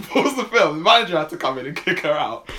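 high, loud telling through laughter; laughing. Real, not acted. Telling friend how woman got kicked out, laughing. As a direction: crack up while telling the story.

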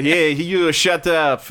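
mock French accent, drawn out. Comic mimicking the French to his friends, French accent. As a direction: put on a slow, haughty French accent. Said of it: "they say with the accent".

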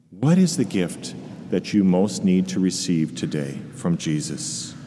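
calm them, leave long pauses. Acted. Continues the guided meditation.

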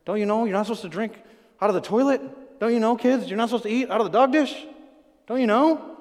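lightly voiced parent scolding kids. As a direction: talk down to the kids, a little exasperated. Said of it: "when you say to your kids".

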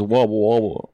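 rumbly cartoon rock creature; lightly voiced gravelly cartoon character; grinding rumbling rock voice. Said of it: "the guy from Chowder that goes".

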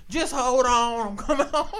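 hyped party call. Retold or imitated. Kids partying to [name].

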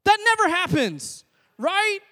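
high, loud, incredulous exclaiming; full-force comic preaching. Real, not acted. Preacher telling parents what toddlers really do with food, loud incredulity. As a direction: belt out the absurd truth to the crowd.

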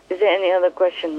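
ordinary talk

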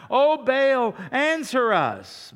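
loud, high, slow desperate plea; pleading cry to Baal. Retold or imitated. Prophets begging Baal to answer, loud desperate wail. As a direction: wail it up to a god who won't answer. Preacher voicing Baal's prophets crying out.

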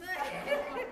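soft high burst of laughter; laughing at the friend's news, high and soft; high breathy laugh. Real, not acted. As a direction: let a quick high laugh escape. Reacting to the flip-off.

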